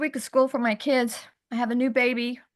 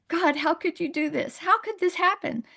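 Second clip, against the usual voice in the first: higher, pained cry to God; anguished plea to God; 7 semitones higher than usual. Retold or imitated. Mother after her son's birth, asking God why, anguished. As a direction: plead with God in disbelief. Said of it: "I was like".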